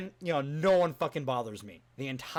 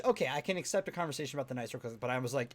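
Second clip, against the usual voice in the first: normal talking voice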